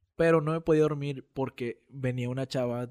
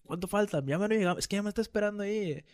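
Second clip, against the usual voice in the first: higher-pitched imitation of a nagging passenger; impatient girl passenger; 7 semitones higher than usual. Girl pestering the bus driver about arrival, imitated impatience. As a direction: pitch it up, pressing the driver for an answer. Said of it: "le volvió a decir al chofer".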